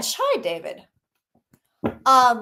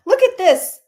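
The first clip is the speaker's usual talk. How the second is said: laughing; 8 semitones higher, 8 dB louder than usual. Real, not acted. Laughing at the card.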